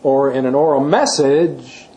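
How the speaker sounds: slow down and punch "message"; stress on "message". Shown as a demonstration. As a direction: land heavily on the final "message". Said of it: "emphasized message".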